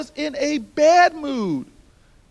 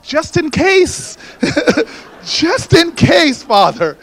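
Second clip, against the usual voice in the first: louder, higher, slowed-down punchline; shouting the joke to the Father; comic shout; 5 semitones higher, 12 dB louder, slower than usual. Real, not acted. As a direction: hit it big and slow for laughs. Joking aside, shouted to Father.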